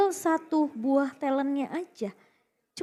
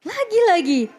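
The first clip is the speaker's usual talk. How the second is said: fighting game announcer; 7 semitones higher, 6 dB louder than usual. Acted. Co-caster hyped commentary.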